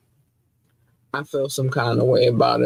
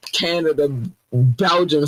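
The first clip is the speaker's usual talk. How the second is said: usual speaking voice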